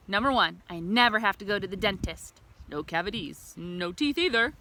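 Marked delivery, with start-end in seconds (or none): none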